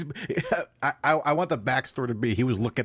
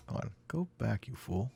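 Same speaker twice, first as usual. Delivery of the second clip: muttered command; 10 dB quieter than usual. Real, not acted. Muttering at video.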